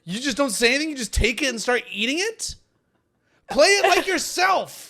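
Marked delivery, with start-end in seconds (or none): 3.5-4.9 high, exasperated yell; loud sharp voice. Real, not acted. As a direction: yell it at your scene partner. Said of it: "Stop yelling at me".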